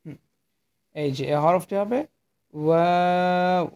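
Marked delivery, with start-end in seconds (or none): 2.5-3.7 ওয়াও drawn out slowly with both lips rounded; teacher modelling ওয়াও with rounded lips; rounded-lip letter demo. Shown as a demonstration. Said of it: "দুই ঠোঁট গোল করে উচ্চারণ করবেন".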